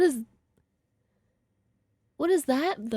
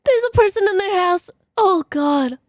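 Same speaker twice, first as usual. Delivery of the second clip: alarmed reaction; 5 semitones higher, 5 dB louder than usual. Real, not acted. Alarmed reaction to figure in video.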